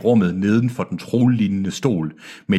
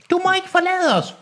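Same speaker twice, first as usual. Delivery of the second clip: very high falsetto cry; Enola's shout. A narrator's reading. Enola begging him not to leave, falsetto cry. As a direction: leap into falsetto to set her apart. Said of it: "råbte Enola".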